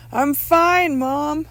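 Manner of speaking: high, loud insistent reassurance; calling back. Acted. Son answers mother through door, loud insistent voice.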